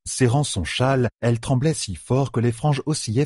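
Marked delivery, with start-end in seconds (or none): none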